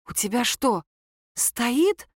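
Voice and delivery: usual speaking voice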